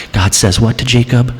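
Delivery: normal talking voice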